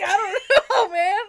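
laughing through the words. Real, not acted. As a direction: crack up mid-line. Reading while laughing.

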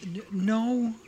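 lightly voiced frightened kid, halting and stammering; a young survivor stumbling through the story; grieving child tells of the attack; scared child.

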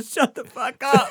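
loud, laughing shut up; laughing shout. Retold or imitated. Hitting his buddy at the hotel counter, he shouts through laughter. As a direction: yell it while cracking up. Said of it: "he's hitting me going".

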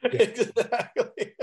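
slow, high laugh-through; agreeing mid-laugh. Real, not acted. Laughing reply.

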